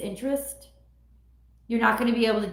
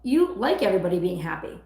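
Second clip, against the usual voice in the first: normal talking voice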